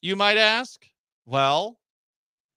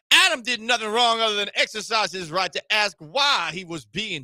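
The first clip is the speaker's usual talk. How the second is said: lightly put-on Southern accent, mostly higher; a hint of a Southern gentleman's drawl; mock southern drawl; 4 semitones higher than usual. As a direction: read the statement as a hammed-up Southern lawyer. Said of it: "read it in my southern drawl".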